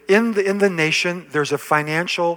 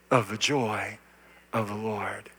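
whisper; 8 semitones lower, 11 dB quieter than usual. Acted. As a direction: drop to a hushed whisper, softer and lower. Sermon line dropped to whisper.

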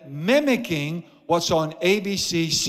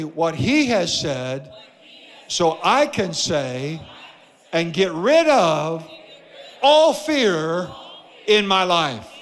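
slow declaration in short phrases, building louder; deliberate, measured chant-like preaching, climbing in force; congregational call-out; 3 dB louder, slower than usual. Real, not acted. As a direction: lead the crowd to repeat, swelling on "all fear". Leads congregation in repeating a declaration.

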